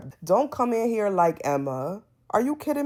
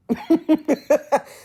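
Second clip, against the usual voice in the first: laughing; 10 semitones higher, 8 dB louder than usual. Real, not acted. Amused at lying claim.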